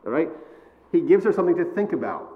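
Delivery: ordinary delivery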